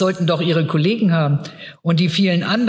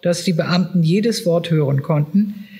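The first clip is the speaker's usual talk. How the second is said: ordinary delivery, nothing marked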